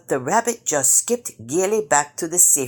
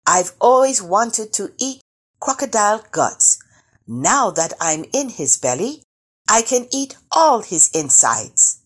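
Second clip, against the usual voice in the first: higher, gleefully boastful call; storybook shout with raised pitch; 6 semitones higher, 4 dB louder than usual. A narrator's reading. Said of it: "he shouted".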